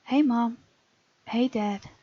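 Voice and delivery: ordinary delivery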